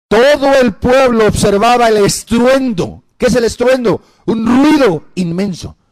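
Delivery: loud, drawn-out scripture proclamation; preach. Acted. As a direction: proclaim the verse, high and slow. (predicación a viva voz, solemne y pausada)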